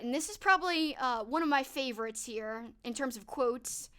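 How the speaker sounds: usual speaking voice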